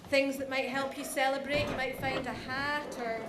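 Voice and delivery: ordinary delivery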